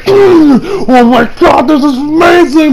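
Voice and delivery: high-pitched mock foodie rapture; moan, then gush over the bite; ecstatic taste reaction. Acting ecstatic first taste.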